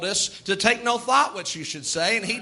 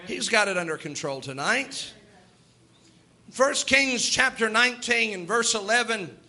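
ordinary talk

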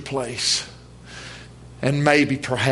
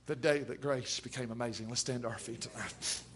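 hushed altar call; 15 dB quieter than usual. Real, not acted. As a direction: drop to a soft, reverent hush. Quiet altar call after climax.

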